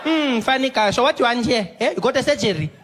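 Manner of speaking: high, loud sarcastic questioning; mocking clerk. Clerk mocking the comedian about his surgery, loud sarcasm. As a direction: needle him with each question.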